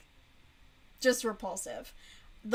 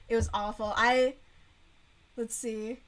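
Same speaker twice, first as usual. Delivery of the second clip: usual speaking voice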